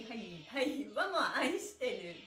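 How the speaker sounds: ordinary delivery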